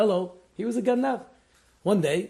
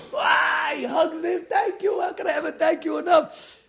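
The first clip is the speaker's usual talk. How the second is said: much higher, loud grateful cry; overjoyed shout; 14 semitones higher, 5 dB louder than usual. Retold or imitated. Father thanking the thief who saved his son, high joyful shout. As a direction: burst out thanking him, overwhelmed.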